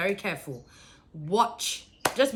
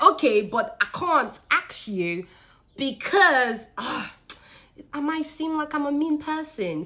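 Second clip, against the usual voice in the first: excited retelling; 8 semitones higher, 6 dB louder, slower than usual. Retold or imitated. Acted quote to employee, excited.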